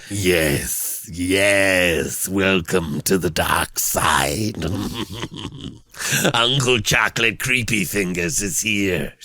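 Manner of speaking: low, slow, sinister Palpatine hiss of welcome. Acted. [name] welcoming the leader to the dark side, low sinister Palpatine voice. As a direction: play the Emperor greeting a new apprentice, drawn out and low. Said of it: "Palpatine voice".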